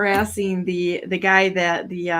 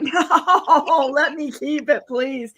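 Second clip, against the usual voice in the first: higher, louder playful begging; mock pleading; 7 semitones higher, 3 dB louder, much slower than usual. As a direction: beg to keep the sign like a kid. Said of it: "And I'm like".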